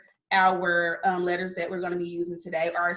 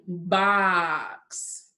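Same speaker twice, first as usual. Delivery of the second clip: BOX drawn out as a question prompt; said slowly; 3 semitones lower than usual. Shown as a demonstration. Teacher asking what sounds we hear, BOX said slowly. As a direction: stretch the vowel in BOX before the ks. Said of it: "We like to say it slowly".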